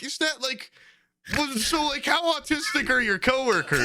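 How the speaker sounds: incredulous laughing question. Retold or imitated. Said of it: "I'm like".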